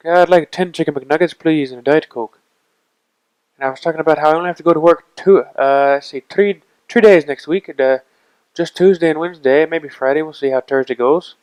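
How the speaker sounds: Irish accent. Shown as a demonstration. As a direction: chat along in an Irish accent. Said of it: "order chicken McNuggets in an Irish accent".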